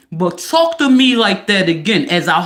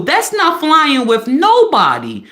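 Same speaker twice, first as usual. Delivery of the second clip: ordinary talk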